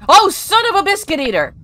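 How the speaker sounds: loud, high yelled mock curse; high-pitched shocked outburst; startled shout. Real, not acted. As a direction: yell it out in sudden alarm. Same curse, now startled.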